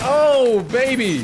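high, loud celebratory shout; excited yell. Real, not acted. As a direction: cheer the win. Celebrating a kill.

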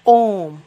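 ओम held three times as long as a short vowel; drawn-out om. Shown as a demonstration. Teacher to students, demoing ओम held three times long. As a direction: stretch ओम into a long pluta vowel. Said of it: "तो जब हम इसको बोलते हैं".

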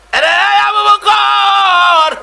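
high, loud, drawn-out cry; Omar's shout. Acted. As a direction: shout it out, stretching the name and the endings. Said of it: "Omar একটা চিৎকার মারলেন". (উঁচু গলায় টানা চিৎকার)

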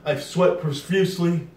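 slow, coughing through hiccups; hiccuping from the heat. Real, not acted. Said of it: "I got the hiccups".

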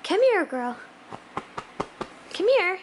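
high, loud call to a puppy; calling the dog. Real, not acted.

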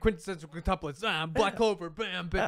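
high, loud teasing rush through titles; excited mock. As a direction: rattle them off like knocking them down. Mocks friend's reading pace excitedly.

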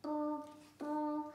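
high buzzing brr; alarm ring. A narrator's reading. Alarm clock going off in the morning, high buzz. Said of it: "went the alarm clock".

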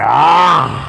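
drawn-out open "ah" cry; imitated leap cry. Co-host mimics the Free Willy leap, crying out. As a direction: let out a sudden sustained cry.

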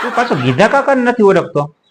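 laughing, pitch jumping up in disbelief; amused disbelief. Real, not acted. Teasing his friend for not knowing Gidha kaka, laughing. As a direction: chuckle as you tease him for forgetting.

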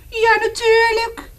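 put-on very high, loud child voice; eager boy. Reading to children, the boy confirms the beasts. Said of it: "zei het jongetje".

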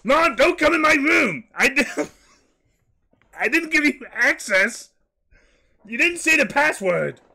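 high-pitched, indignant outburst; very high, outraged kid voice; shrill yelling at mom. Acted. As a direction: shout mom out of your room. My mom came in my room.

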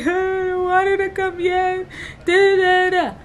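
high, slow imitated wail; mock crying. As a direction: act out sobbing protest. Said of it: "And I'm just crying".

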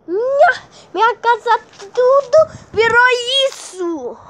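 a hammed-up sob over the ruined house; mock crying. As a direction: wail it like the world ended. House destroyed, crying again.

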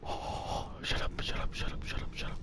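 urgent whispered shushing; whispering. Real, not acted. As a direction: hush yourself, no voice. Hushing near an enemy.

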